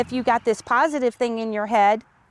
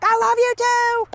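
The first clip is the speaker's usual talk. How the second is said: high, sustained mock holler; yelling back; 13 semitones higher, 4 dB louder than usual. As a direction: call it back across the yard. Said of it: "And I'll yell back".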